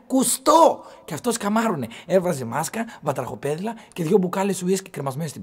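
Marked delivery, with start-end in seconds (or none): 0.1-0.8 high, loud mock call; φωναχτό παρατσούκλι. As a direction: call the nickname out at full voice, high-pitched. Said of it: "το φωνάζαμε". (δυνατή, ψιλή κοροϊδευτική φωνή)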